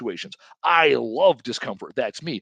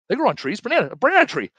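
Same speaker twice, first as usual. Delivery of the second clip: insistent dad; 9 semitones higher, 4 dB louder, faster than usual. Retold or imitated. Dad insisting in retold argument.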